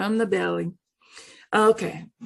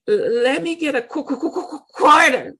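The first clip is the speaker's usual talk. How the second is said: a light mock stutter on the q, a touch higher; 6 semitones higher, 4 dB louder than usual. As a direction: stammer through the request for a coin. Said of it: "He stutters".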